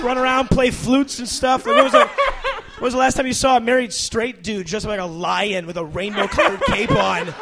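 ordinary talk

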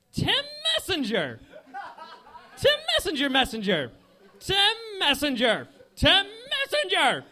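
high, loud cartoon creature calling its own name; a creature battling, chanting its name; Pokemon cry. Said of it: "my impression of myself as a Pokemon".

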